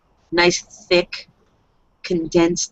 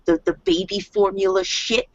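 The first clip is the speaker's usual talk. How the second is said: usual speaking voice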